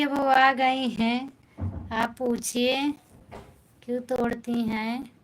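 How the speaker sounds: usual speaking voice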